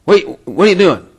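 husband retelling his wife's sharp call; imitating wife. And she said.

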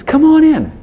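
high, loud sing-out invitation; welcoming call. Acted. God to the sinner outside, welcoming call.

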